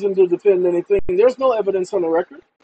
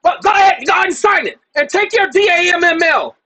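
much higher, louder, sneering clerk; mock shouting clerk; 9 semitones higher, 5 dB louder than usual. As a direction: throw it at him like the angry clerk. Clerk snapping, retold quarrel.

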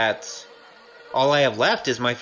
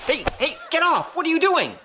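high, alarmed cries of protest; startled protest. Acted. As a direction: yelp it out as hands grab you. Grabbed and seized by thugs.

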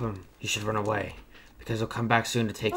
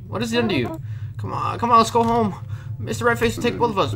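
put-on high, loud, urgent child voice; pleading child; 14 semitones higher, 8 dB louder than usual. Child begging captured dad to come home, urgent high voice.

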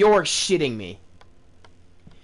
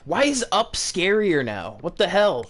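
ordinary talk

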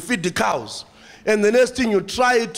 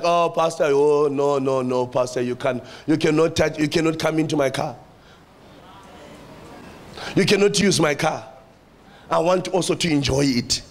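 mock stingy churchgoer; 6 semitones lower than usual. Selfish member refusing the pastor.

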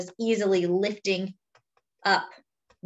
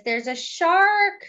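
higher, louder and slow; mock alarm; 10 semitones higher, 4 dB louder, slower than usual. Storyteller warning kids of a shark, mock alarm. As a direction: gasp it out like a scary reveal.

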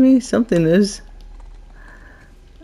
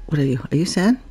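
ordinary talk

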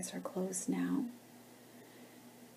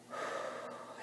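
long breathy exhale through the mouth; audible relaxing sigh; demonstrated exhale; 8 dB quieter than usual. Shown as a demonstration. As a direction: let the breath out slowly, as a guide would. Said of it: "Exhale through the mouth".

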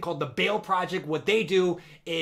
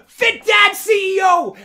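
excited shout; 12 semitones higher, 13 dB louder, slower than usual. Real, not acted.

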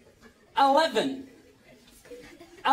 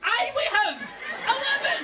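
exasperated yell; 10 semitones higher than usual. Real, not acted. Yelling back at the lift.

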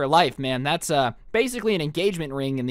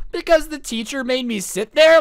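loud, high-pitched mock protest; re-enacted outburst, pitched up and loud; exasperated retort; 7 semitones higher, 5 dB louder than usual. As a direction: fire back in raised, incredulous exasperation. Exasperated retort in retold argument.

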